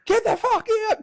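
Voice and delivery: very high-pitched mock screech; high, hammed-up angry order; furious sister. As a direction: imitate an outraged sister throwing him out. Angry sister confronts him in retelling.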